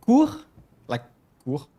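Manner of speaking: "Cour" alone, said "koor" with French r; syllable breakdown. Shown as a demonstration. Teacher breaking the name down for viewers, isolated French syllable "Cour". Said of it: "Listen very closely".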